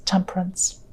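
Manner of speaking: soft whispered card name. Real, not acted. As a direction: breathe the word out.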